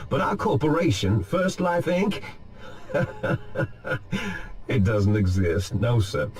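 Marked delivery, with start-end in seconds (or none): 2.3-4.6 breathy scheming laugh under the breath; soft sinister chuckle. Acted. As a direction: chuckle quietly at your own secret. Villain revealing the fake company.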